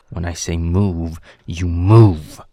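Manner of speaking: loud order, landing hardest on the last words; angry command. Acted. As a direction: demand instant obedience, very loud. Barking orders at captive.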